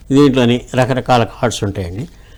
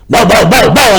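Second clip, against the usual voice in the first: loud, higher bow-wow barks; dog barking; 6 semitones higher, 5 dB louder, much slower than usual. A narrator's reading. Dog barks back at the cat. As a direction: bark it out like a dog. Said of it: "అని అరిచింది".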